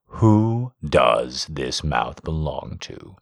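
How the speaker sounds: low, slow, possessive. A narrator's reading. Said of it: "he asked".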